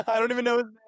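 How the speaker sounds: high squeaky laugh through the words; laughing disbelief. Real, not acted. To the host about the stranger who changed his life, laughing high.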